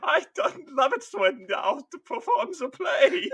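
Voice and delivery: hammed-up gleeful praise through laughter; squealing delight, cracking up; laughing mock delight. Mock archaic marine delight.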